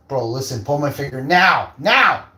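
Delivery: loud, high, insistent order; urgent joking command. Pretend. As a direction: build to a shouted "now".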